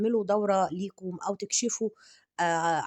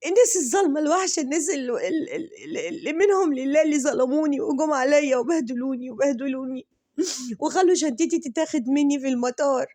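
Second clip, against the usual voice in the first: whiny hammed-up weeping, much higher; mock crying complaint; 5 semitones higher, 4 dB louder than usual. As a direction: whine the complaint through fake tears. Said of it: "قالت لك". (تقليد شكوى باكية بصوت أعلى بكثير)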